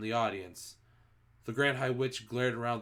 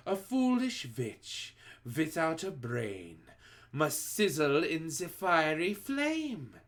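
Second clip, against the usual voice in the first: slow sing-song witch verse; cruel recital; 5 semitones higher, slower than usual. A narrator's reading. Grand High Witch reciting a death rhyme, slow sing-song menace. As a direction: savour the doom.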